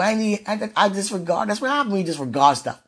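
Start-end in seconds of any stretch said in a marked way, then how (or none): none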